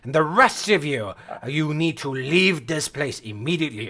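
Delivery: lightly voiced commanding reprimand; firm, emphatic telling-off; stern battle master. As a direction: lecture the youngsters with authority.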